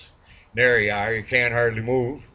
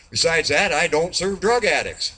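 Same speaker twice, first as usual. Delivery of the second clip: higher, indignant bartender refusal; emphatic refusal; 5 semitones higher, 3 dB louder than usual. Acted. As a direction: put your foot down, voice raised in pitch. Bartender firmly refuses the bear.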